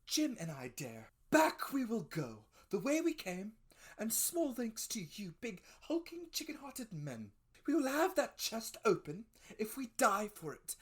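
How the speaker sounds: high, loud, scornful mother's voice; indignant mother. A narrator's reading. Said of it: "she said".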